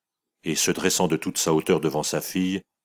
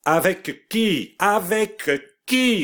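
«Avec qui» lent, très aigu, crié; martèle la question en montant; cri répété; 4 dB louder, much slower than usual. A narrator's reading. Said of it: "brutalement elle ajouta". (mère hors d'elle qui hurle la question)

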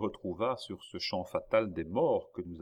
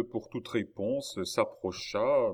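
ordinary delivery

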